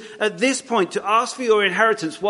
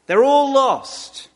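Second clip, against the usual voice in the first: ALL drawn out high and slow; a preacher's slow, weighty verdict; emphatic; 6 semitones higher, 3 dB louder, much slower than usual. Acted. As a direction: land "They're all lost" slowly, stress on all. Sermon emphasis.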